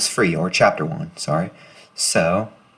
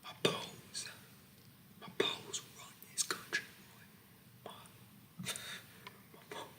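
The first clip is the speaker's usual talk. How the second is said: menacing drill sergeant in a soft whisper; creepy whispering sergeant; 11 semitones lower, 10 dB quieter than usual. Acted.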